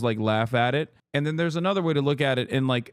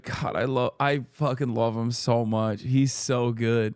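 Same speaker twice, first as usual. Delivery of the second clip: usual speaking voice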